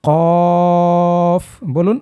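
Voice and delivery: কফ held long, tongue root on the palate; long qaf demo. Shown as a demonstration. Teacher holds কফ for learners to copy. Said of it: "আপনি আমার সাথে সাথে বলুন".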